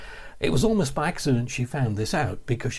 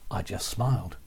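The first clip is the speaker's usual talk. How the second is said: ordinary talk, nothing marked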